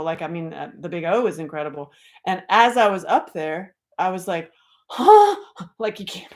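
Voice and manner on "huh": loud, very high mock gasp; sudden awestruck "huh", acted out; astonished gasp. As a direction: gasp in hammed-up amazement. Acting out her astonishment up there.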